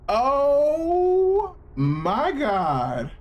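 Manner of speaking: long high shocked "oh my God" wail; stunned viewer wailing at the screen; drawn-out wail. Real, not acted. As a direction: stretch it out in horror, way up high. Shocked reaction to gory scene.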